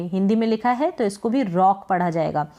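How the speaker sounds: ordinary delivery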